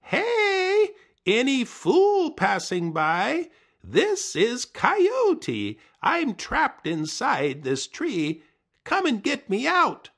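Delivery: high, loud, slow calling out; slow, high yelling plea; Coyote calling for help. Acted. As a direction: holler through the trunk for anyone. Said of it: "called for help".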